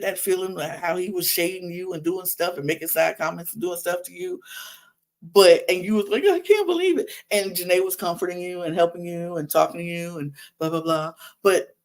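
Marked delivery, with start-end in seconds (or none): 6.3-7.1 high-pitched mimicked disbelief; mocking, squeaky-high complaint; mock upset whine. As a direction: imitate someone wailing in hurt disbelief. Mimics her earlier hurt complaint.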